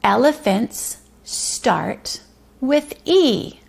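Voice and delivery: slow, deliberate sentence read-aloud; slow, clear reading. Shown as a demonstration. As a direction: stretch each word out slowly. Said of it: "the morning message is".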